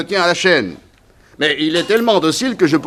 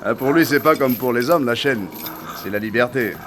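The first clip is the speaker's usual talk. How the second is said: normal talking voice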